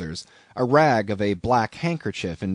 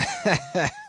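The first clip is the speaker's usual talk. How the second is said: higher-pitched mocking chuckle; solemnly derisive laugh; 8 semitones higher, 3 dB quieter than usual. A narrator's reading. Stranger scoffing at a captain's recovery, derisive laugh. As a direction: laugh in scornful disbelief. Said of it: "with a solemnly derisive sort of laugh".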